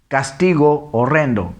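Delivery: deliberate two-word reading; read separately. Shown as a demonstration. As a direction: keep both o sounds distinct. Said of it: "se une".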